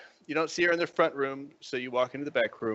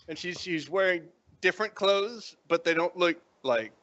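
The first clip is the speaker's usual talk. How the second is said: ordinary talk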